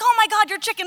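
high, loud alarmed cry; mock panic over the dead chicken; imitating startled fairgoers, high and loud; panicked bystander. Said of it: "they're like".

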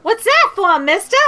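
put-on high, sassy street kid; cheeky kid. Rickshaw kid sasses the customer in a high kid voice. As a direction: talk back like a cocky urchin. Kid gets a gold piece.

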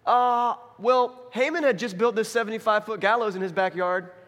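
mock-helpful attendant; palace attendant. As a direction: offer the suggestion up high, easing down at the end. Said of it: "there's this attendant in the room".

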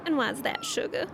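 syrupy Southern belle; Southern accent. Acted. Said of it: "Why can you do a Southern accent?".

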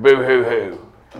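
slow mock sobbing; boo-hoo it slowly, mocking; fake crying. Mocks the prisoner's shriek.

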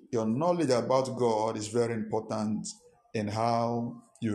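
dictating a point for listeners to note; measured dictation. Real, not acted. Repeats key point for congregation to note.

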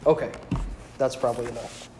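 ordinary talk

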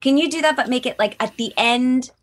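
high, bright mock director asking for retakes. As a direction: play a chirpy voice director. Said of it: "Just to be like".

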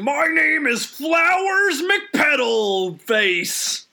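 slow, high hammed-up villain shout; villain yell. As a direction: roar a silly name slowly like a cartoon baddie. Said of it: "That's like saying".

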